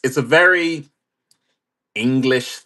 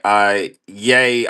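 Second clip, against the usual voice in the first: usual speaking voice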